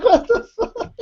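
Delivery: high-pitched incredulous squeal through a laugh; mock outrage, squeaking high. Retold exasperated reaction to friend.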